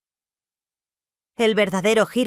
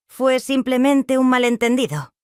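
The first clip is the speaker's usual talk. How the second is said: higher, lightly insistent explanation; trying to sound casual; attempted light tone; 7 semitones higher, 4 dB louder than usual. A narrator's reading. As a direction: keep it light, pitched up. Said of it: "tratando de mantener un tono ligero".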